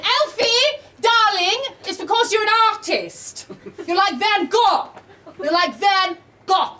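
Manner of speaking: high, theatrical posh-mother voice; imitated mum. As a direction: play an encouraging mother calling her darling child. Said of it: "She went".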